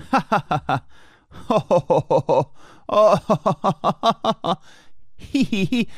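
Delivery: hammed-up ha ha ho ho; fake laughs. Solo silly laughs into mic.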